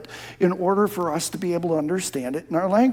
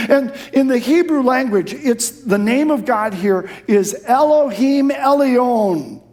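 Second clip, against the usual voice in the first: full-force preaching; 6 semitones higher, 5 dB louder than usual. Acted. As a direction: build each phrase like a revival peak.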